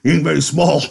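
loud, high jokey big-man voice; Carlson. A narrator's reading. Big man joking about a newcomer's name, loud and jocular. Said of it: "He chuckled softly at his joke".

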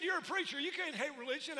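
incredulous contractor pushing back. Retold or imitated. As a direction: push back hard in his voice. Contractor's retold objection.

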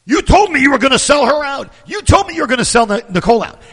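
high, loud accusing yell; imitated yelling.

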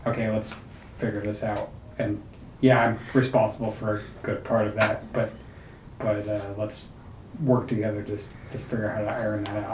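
calm, hushed owning-up; gentle reassurance. Acted. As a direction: quietly offer to work it through together. Acting a parent reassuring damaged children.

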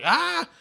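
short high-pitched mock yell; mock scream. Podcaster retelling a moviegoer screaming outside the theater, mock scream. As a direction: imitate a man screaming, not at full volume. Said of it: "just screams at the top".

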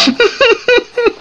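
short real laugh; amused laugh. Real, not acted. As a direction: burst out laughing. Laughs at the scene.

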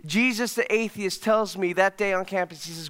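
normal talking voice

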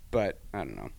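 ordinary talk, nothing marked

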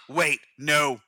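ordinary delivery

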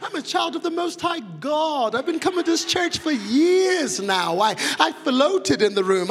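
high, mock-grand protest; shocked churchgoer. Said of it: "Some of you are shocked that I even asked".

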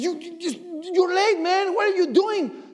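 scolding pastor. Retold or imitated. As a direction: chew him out like his pastor did. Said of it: "he chewed my face there".

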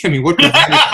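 real burst of laughter, high and loud; breaking into helpless laughter mid-question; laughing. Real, not acted. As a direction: laugh it out in disbelief. Laughing at his own question.

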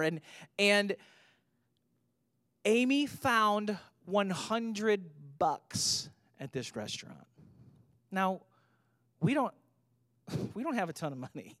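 usual speaking voice

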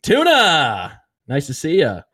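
high, drawn-out hailing call; jovial greeting sung out high on the first word; Andy. Retold or imitated. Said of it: "Andy goes".

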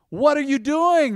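high, slow mocking question; scoffing critic. Critics mocking Nehemiah's builders, high scoffing question. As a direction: sneer it like a critic.

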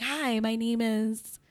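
slow, soft, racially ambiguous phone-sales greeting; telemarketer voice. Shown as a demonstration. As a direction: put on a slow, quiet telemarketer voice. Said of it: "I can be racially ambiguous".

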